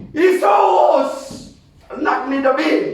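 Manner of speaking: high, loud shout of the name. Retold or imitated. Blind Bartimeus shouting to Jesus, loud cry. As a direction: call out to him over the crowd. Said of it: "nung sumigaw si Bartimeus".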